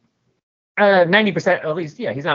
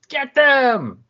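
high, loud "Get them!"; furious command; 13 semitones higher, 5 dB louder than usual. Acted. Angry parent rousing the mob to attack, a furious shout. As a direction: send the mob charging.

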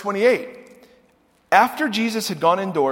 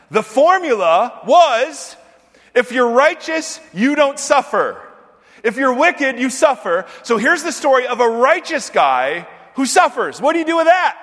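higher and louder, driving the point home; preaching at full force; 6 semitones higher, 6 dB louder than usual. Real, not acted. Preacher presses the congregation about Job's suffering, full-force preaching. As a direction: preach it hard, rising with conviction.

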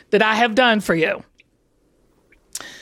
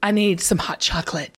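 lower, deadpan request; very serious; 6 semitones lower, 4 dB quieter than usual. Shown as a demonstration. Showing a co-host the line for camera, very serious. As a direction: say it gravely, pitched down. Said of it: "Be very serious".

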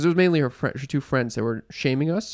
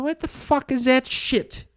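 higher, slow, drawn-out what the hell; slow, pitched-up outraged disbelief; incredulous disgust; 8 semitones higher, slower than usual. Retold or imitated. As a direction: stretch it out in mock disbelief. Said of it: "I'm like".

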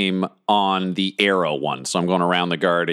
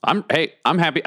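normal talking voice

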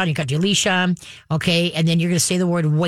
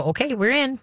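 higher-pitched happy-to-follow dog voice; contented dog; 7 semitones higher than usual. Acted. Trainer voices dog coming into house, higher and agreeable. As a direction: lift the pitch as the dog checking in.

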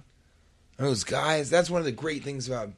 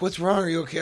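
concerned wife; 6 semitones higher, 4 dB louder, faster than usual. Retold or imitated. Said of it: "She's like".

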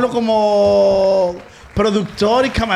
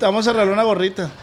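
fast; much faster than usual. Real, not acted. Fast announcement to guest.

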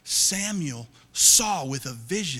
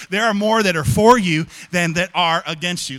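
preached declaration; 3 semitones higher, 12 dB louder than usual. Real, not acted. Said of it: "Let me try this side over here".

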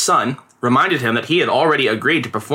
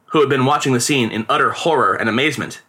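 plain reading voice

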